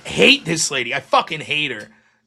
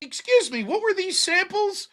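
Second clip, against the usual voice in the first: mock polite query; 12 semitones higher than usual. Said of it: "I was like".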